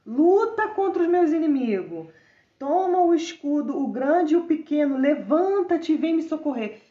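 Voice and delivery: high, loud, urgent plea to God; fervent psalm reading. Acted. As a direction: cry out the psalm with force. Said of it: "Olha só como ele começa". (clamor fervoroso e alto a Deus)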